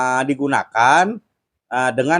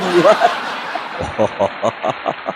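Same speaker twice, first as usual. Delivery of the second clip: long airy laugh, rising at the end; real laughter at his own story; breathy laugh; 3 semitones lower than usual. Real, not acted. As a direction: let the laugh roll out after the punchline. Laughing at the sandal punchline.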